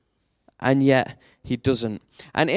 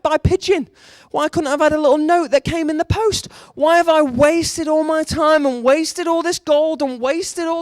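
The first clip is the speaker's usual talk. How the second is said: angry Naaman; 12 semitones higher than usual. Retold or imitated. Naaman rages to the crowd about Syria's rivers, high indignant shouting. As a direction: fume like an offended general who was snubbed. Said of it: "Naaman goes away angry".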